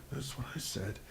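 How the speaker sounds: whispered retold aside; muttered under breath. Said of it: "say under your breath".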